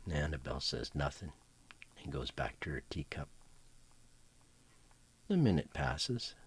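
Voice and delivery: ordinary talk, nothing marked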